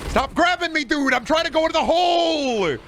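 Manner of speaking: very high, loud exasperated yelp at the game; frustrated yell. Real, not acted. Streamer yelling at an enemy grabbing him, exasperated. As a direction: yell at the monster grabbing you.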